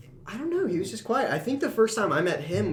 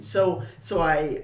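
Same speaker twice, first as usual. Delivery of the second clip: ordinary talk, nothing marked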